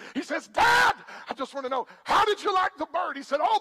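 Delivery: building, shouting punchline preacher; preaching at full force. Acted. Preacher shouting the bird joke punchline to church. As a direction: belt the joke's climax to the crowd.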